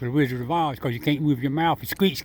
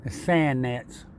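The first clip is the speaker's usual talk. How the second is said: ordinary talk, nothing marked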